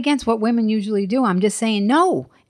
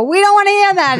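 high, loud, sneering put-on voice; mocking dismissive critics; 12 semitones higher, 7 dB louder than usual. Mimicking critics who refuse her answers, high and loud. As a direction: ham up the people who brush her off. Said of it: "they go".

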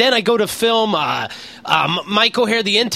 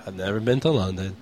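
very low, quiet deadpan; straight-faced; 11 semitones lower, 7 dB quieter than usual. Retold or imitated. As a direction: state it drily like a formal denial. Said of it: "do I have to have a straight face".

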